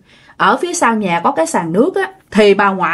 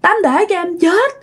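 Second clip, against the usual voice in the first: high-pitched acted pleading; desperate cry; 10 semitones higher than usual. Ngân begging the neighbour to let her die, crying out desperately. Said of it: "cô Ngân mới la".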